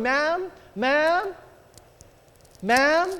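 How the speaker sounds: calling out. Retold or imitated. Teller to a customer caught up in talk, calling out. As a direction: imitate a clerk trying to get noticed.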